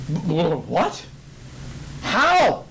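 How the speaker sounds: stammering, rising high how; stunned stammer. Acted. The king stunned at the unharmed men, stammering high. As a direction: sputter in disbelief. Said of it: "He's like".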